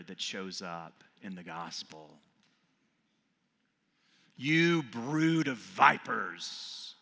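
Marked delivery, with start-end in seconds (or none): 4.4-7.0 slow, loud denouncing yell. Acted. John the Baptist yelling at the Pharisees, slow and loud. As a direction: hurl it at them like a prophet. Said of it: "he yells at the Pharisees".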